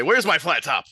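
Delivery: furious chef confronting the cook; angry demand. Acted. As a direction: chef threatening the cook with a barbecue fork, angry shout. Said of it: "very angry".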